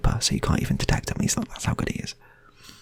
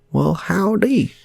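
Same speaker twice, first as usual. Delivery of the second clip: high, slow, loud Southern drawl; cowboy shopkeeper, way up high; American shopkeeper accent; 7 dB louder, slower than usual. Retold or imitated. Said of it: "I can only do two American accents".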